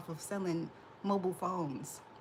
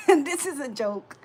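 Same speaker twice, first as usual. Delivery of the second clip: This is a joke said through real laughter, louder and slower; This is a joke broken up by her own laughter, louder and slower; laughing; 6 semitones higher, 9 dB louder than usual. Real, not acted. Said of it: "Let me just laugh".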